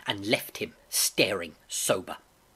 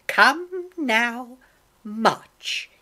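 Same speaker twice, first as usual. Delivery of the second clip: high, loud, drawn-out order; cruel and cold; 11 semitones higher, 6 dB louder, much slower than usual. A narrator's reading. Blind man interrupts the boy, cruel and cold. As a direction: cut in with a cruel command. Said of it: "a voice so cruel and cold and ugly".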